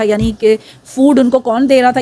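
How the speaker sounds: ordinary talk, nothing marked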